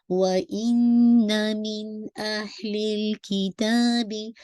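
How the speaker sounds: slow melodic tajweed recitation with held ghunna; Quran recitation. Shown as a demonstration. Teacher recites the verse to her students, slow tajweed chant. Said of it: "नून साकिन के बाद हमज़ा आ रहा है".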